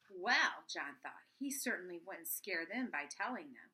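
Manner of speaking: steady read-aloud storytelling; bedtime-book reading voice; story narration. A narrator's reading. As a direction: read the chapter to the kids. Mother reads chapter to children.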